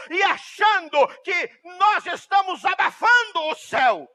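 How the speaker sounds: high, loud denouncing sermon; full-force preaching. Acted. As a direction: thunder it at the congregation. (pregação inflamada, gritando em tom agudo)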